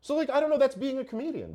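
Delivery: emphatic outburst. Real, not acted. Emphatic exclamation.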